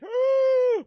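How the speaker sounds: loud, high dramatic gasp; sharp gasp. Real, not acted. Reacting to the suspect's confession, big gasp. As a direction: gasp as if at a horror reveal. Reactor guessing bag contents.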